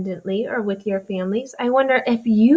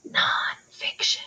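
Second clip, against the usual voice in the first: whisper yelling; 6 dB quieter, slower than usual. Shown as a demonstration. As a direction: whisper it like a shout. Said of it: "I want you to whisper shout what it is".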